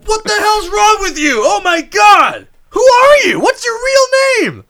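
hammed-up outraged shout, climbing high and loud; outraged Alec Baldwin. Imagining a husband learning of his wife's fake accent, hammed-up outrage. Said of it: "I'm just imagining, like, Alec finding out".

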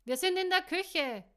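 high, loud shout to another room; calling back. A narrator's reading. Host answering the visitor in the hall, calling back. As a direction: holler back so it carries down the hall.

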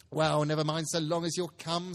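a mother asking where everyone is; Mrs Cratchit's voice. Acted. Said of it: "said Missus Cratchit".

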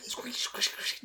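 breathy whispered squeak noises; soft mock squeak, almost voiceless. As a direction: whisper the creak of leather boots.

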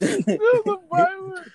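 high, laughing exclaim. Real, not acted. Laughing at the joke.

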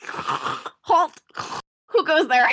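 high, loud herald's shout; page voice. Acted. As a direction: bark it like a pompous page. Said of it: "I don't wanna hear this voice".

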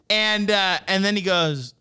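ordinary talk, nothing marked

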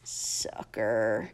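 breathy scornful whisper. Acted. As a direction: hiss the word under your breath. Whispered insult in story.

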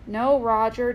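plain reading voice

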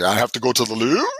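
imitated British accent, rising at the end; mock British accent. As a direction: tease with a put-on British lilt. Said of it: "put on a, a British accent".

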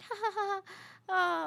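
high, forced ha-ha-ha; mock nervous laughter, pitched high; awkward fake laugh. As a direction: put on a polite, uneasy laugh. Acting out her uneasy laughed reply.

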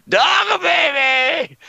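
drawn-out shout. Acted. Friend bellowing the nickname at the group, drawn out. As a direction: howl the nickname out long.

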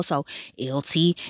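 ordinary talk

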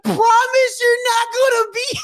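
very high, pleading mock wail; hammed-up tearful squeal; crying. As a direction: beg like a bawling child. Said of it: "I'm just, like, crying".

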